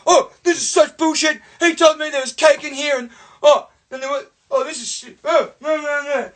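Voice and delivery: high, indignant protest; outraged little brother. Retold or imitated. Tricked brother protesting to Mum, high indignant complaint.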